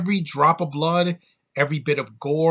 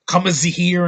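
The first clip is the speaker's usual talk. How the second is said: mock German; 5 semitones higher, 9 dB louder than usual. Imitating German phrase.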